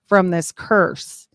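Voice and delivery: "From this curse" said very slowly, each word leaned on; slow it right down and weigh "curse"; pointed, deliberate rereading of "From this curse"; emphatic reread. Retold or imitated. Re-reading the quote for emphasis.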